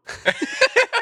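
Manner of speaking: high burst of laughter; laughing. Real, not acted. Man laughing at strangers calling him Woo, high laugh.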